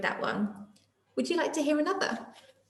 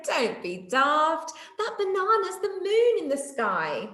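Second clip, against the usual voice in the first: slow, higher teasing retort; mock laughing; 6 semitones higher, slower than usual. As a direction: laugh at a silly friend. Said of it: "Night Monkey laughed and said".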